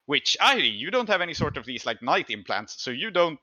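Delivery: normal talking voice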